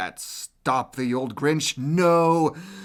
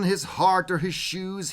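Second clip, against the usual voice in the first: plain reading voice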